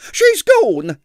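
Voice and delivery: very high, loud fib; landlady lying to protect the guest; shrill lie. A narrator's reading. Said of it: "lied Miss Treacle".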